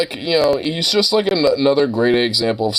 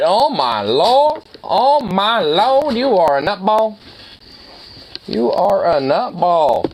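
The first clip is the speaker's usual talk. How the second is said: excited dog talk; 7 semitones higher, slower than usual. Real, not acted. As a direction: gush over the goofy dog.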